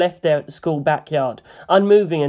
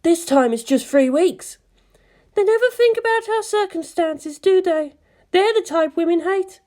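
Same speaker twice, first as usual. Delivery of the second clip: much higher, wry woman's voice; high musing Misato; 14 semitones higher than usual. Acted. Misato muses to the bridge crew in a high wry voice. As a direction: muse dryly about the angels. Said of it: "Misato mused".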